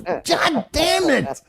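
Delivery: slow, high, drawn-out mock despair; exasperated. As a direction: stretch the words out like you give up. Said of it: "I was".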